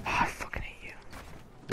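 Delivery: slow unvoiced whisper. Real, not acted. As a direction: breathe it out slowly. Muttered frustration at teammate.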